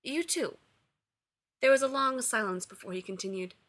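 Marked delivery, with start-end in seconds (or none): 0.0-0.6 uncertain. A narrator's reading. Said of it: "he smiled uncertainly".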